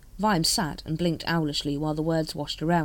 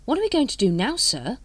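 higher, louder worried question; a touch of anxiety, pitched up; anxious; 7 semitones higher, 4 dB louder than usual. A narrator's reading. Carrot asking his captain what to do, anxious. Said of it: "said Carrot anxiously".

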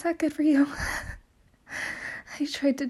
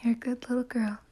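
whispering; 5 dB quieter than usual. Real, not acted. Intimate whispered line.

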